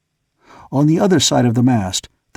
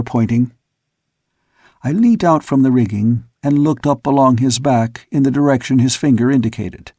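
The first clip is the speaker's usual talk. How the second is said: ordinary delivery, nothing marked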